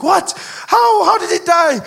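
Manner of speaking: high, loud acted shock; stunned questions pitched right up; shocked outcry. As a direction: cry out in mock disbelief. Grandmother hears of a death.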